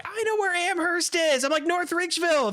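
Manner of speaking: high, chirpy exchange; mock excited. Retelling chat with graduates about suburbs, high and excited. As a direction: bounce it up high like excited chatter.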